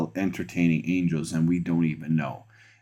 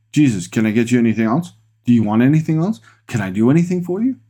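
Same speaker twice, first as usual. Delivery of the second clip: fast, rising questions, climbing higher; eager to please; 5 semitones higher, 5 dB louder, much faster than usual. Pretend. You offering Jesus more help, eagerly. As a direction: play an over-eager helper, tumbling over the offers.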